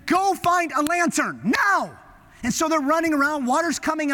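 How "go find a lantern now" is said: slow, high shouted command; urgent order. Retold or imitated. Captain shouting to his men to find a lantern. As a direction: bark it at the crew over the storm. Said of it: "quickly he says to his men".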